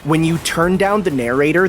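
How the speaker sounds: ordinary delivery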